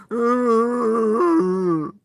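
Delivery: high, loud exasperated laugh almost a cry; bitter laugh. Real, not acted. Vlogger laughs bitterly at the troll, half crying. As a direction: laugh out the frustration, near breaking.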